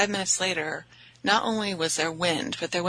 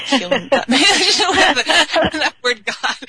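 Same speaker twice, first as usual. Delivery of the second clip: laughing through the words, pitch up high; 10 semitones higher, 8 dB louder, much slower than usual. Real, not acted. Jokes about booking a session and laughs. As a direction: giggle at her own joke about the word. Laughing at her own joke.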